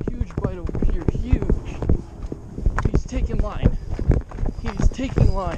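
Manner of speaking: urgent play-by-play while reeling; fighting a fish. Real, not acted.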